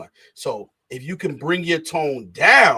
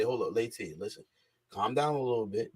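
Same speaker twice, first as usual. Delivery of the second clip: softer, lower, calming someone down; calm de-escalation; 6 semitones lower, 10 dB quieter than usual. Shown as a demonstration. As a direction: show how to cool an argument. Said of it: "I say".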